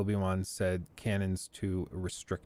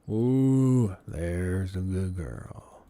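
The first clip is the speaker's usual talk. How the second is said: soothing a pet; 5 semitones lower, much slower than usual. Real, not acted. Soothing a horse or dog.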